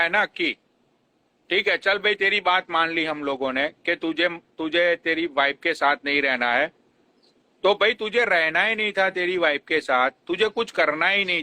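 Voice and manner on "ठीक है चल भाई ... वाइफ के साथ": normal talking voice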